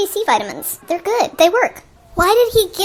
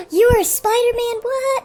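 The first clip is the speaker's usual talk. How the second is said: much higher, astonished; shocked cop; 9 semitones higher, slower than usual. Retold or imitated. The cop unmasking Peter and finding Spider-Man, much higher and astonished. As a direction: blurt it out in disbelief. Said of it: "he should have been like".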